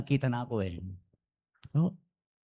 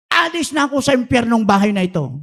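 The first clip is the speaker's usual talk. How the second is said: mock shout; 8 semitones higher, 6 dB louder than usual.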